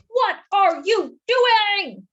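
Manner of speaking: slow, high alarmed shout. Acted. Arnie the donut yelling at Mister Bing, high and loud. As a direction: yell it in horror, drawn out. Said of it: "shouted Arnie".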